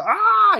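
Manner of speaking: high, loud mock scream; sudden yelp of pretend panic; panicked scream. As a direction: scream as if attacked, hammed-up. Acts out panicked reaction to attack.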